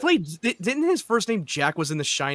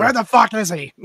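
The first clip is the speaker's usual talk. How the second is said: higher, louder mock Boston Batman demand; mock Boston accent; 6 semitones higher, 3 dB louder than usual. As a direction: growl it as a gruff Boston Batman. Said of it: "he had to do the Boston accent".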